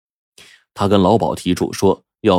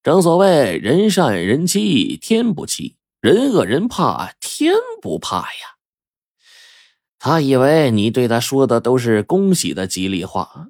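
sage fortune-teller explaining; 8 semitones higher than usual. Acted.

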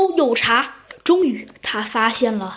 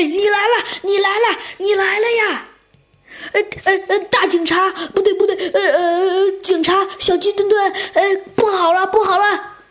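a touch of hammed-up panic; frantic clamoring; 6 semitones higher than usual. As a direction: babble on in a panicky rush. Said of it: "你不要再嚷嚷了".

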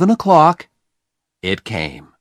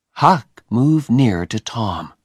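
usual speaking voice

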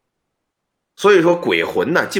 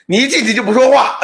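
louder, higher, fed-up scolding; angry complaint; 8 semitones higher, 3 dB louder than usual. Retold or imitated. Old man scolding the silent ghost pot, angry.

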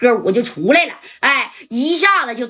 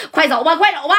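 urgent shout; 6 semitones higher than usual. Retold or imitated. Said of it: "加代就喊了".

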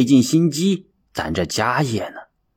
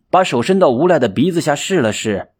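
usual speaking voice

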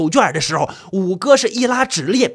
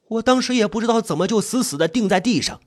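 usual speaking voice

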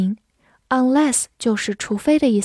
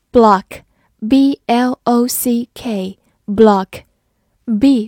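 slow spelling; 4 dB louder, much slower than usual. Shown as a demonstration. Teacher slowly spelling block for students. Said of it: "spelling a new word".

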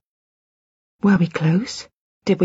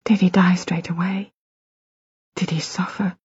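hushed, breathless questions; whisper; 4 dB quieter than usual. Acted. Mother asking husband about son's death, whispered. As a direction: barely voice the fear. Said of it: "my voice dropped to a whisper too".

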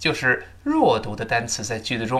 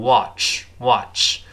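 American "watch" with a more open "ah" vowel; American pronunciation; 2 semitones lower than usual. Shown as a demonstration. Teacher contrasts it with British, slow American "watch". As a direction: say "watch" the American way, slowly, mouth wider. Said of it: "美国人口型稍大一点".

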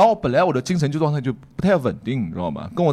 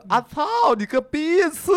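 put-on high, slow Guangdong-accented curse; drawn-out curse in Cantonese-accented Mandarin; 11 semitones higher, slower than usual. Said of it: "我来学一下，他说：/他是用这个广普来讲的啊".

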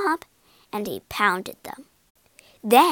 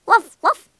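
two loud, high woofs; quick double bark; dog barks; 10 semitones higher, 10 dB louder than usual. A narrator's reading. Kate, under spell, barks.

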